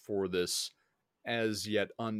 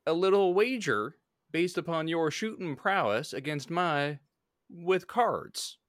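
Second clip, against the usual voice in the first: higher, louder frontier gambler; smooth-talking debt collector; Western gambler voice; 8 semitones higher, 5 dB louder than usual. Acted. Player voices gambler character.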